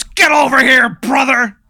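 high, loud wrestler taunt; hammed-up wrestler. Wrestler calling the guest into the ring, in a high loud taunt. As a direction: roar it like a pro wrestler.

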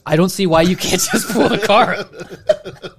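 high, loud, slowed imitation; amused mock-whiny quote, stretched out; laughing mimicry. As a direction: mimic the complaint slowly, breaking into laughter. Quotes wife's line while laughing.

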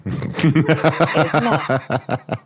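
real burst of laughter; laughing. Real, not acted. Amused caller cracking up on the line, laughing.

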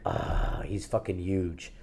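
breathless whisper of awe at a huge moose; hushed awe. Retold or imitated. Hidden hunter spotting a huge moose, whispered awe. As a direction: whisper so the moose won't hear.

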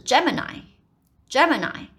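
pronunciation demo of Gemini; correct Gemini. Shown as a demonstration. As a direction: say Gemini, ending on a long "eye". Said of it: "它的念法其实是I".

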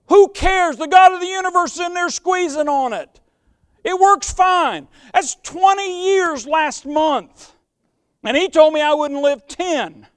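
high, loud preacher's cry; full-voiced revival preaching; fiery preaching. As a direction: preach it out at full force to the church.

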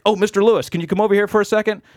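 high, loud, hurried call; imitated eager hail. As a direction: flag down someone important. Stranger calling him over.

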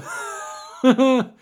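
high imitated cackle; mock prankster laugh. Prankster revealing his can of Bang, mock laugh. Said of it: "pull out his can of Bang and go".

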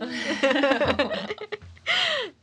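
long high laugh; real drawn-out laughing fit; laughter. Real, not acted. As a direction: burst out laughing after mock despair. Laughs at own mock despair.

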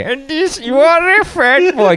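very high, slow mock Indian accent; hammed-up, high Indian-accented insult; fake Indian voice. Shown as a demonstration. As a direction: jump up into an exaggerated Indian accent. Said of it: "Can you tell the difference?".

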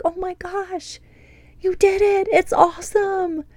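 put-on high, bright teacher praise to a small child; praising a child. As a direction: cheer a kindergartner who just read. Praising a child who read.

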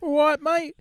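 loud, high-pitched mock Brit; British accent. Heckling a mate who mocks the British accent, mock British. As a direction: squawk it as a caricature British lad. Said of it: "But the British accent".

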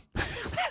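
a quick, high burst of laughter; short laugh. Real, not acted. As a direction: laugh it off, a little awkward. Awkward laugh at odd memory.

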